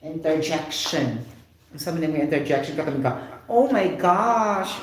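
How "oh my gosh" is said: slow, drawn-out oh my gosh with a rising-falling swoop; expressive exclamation. Shown as a demonstration. Teacher showing students a lively interjection tone. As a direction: stretch "Oh my gosh!" slowly, stressing "oh" with feeling. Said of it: "Take note my, my tone".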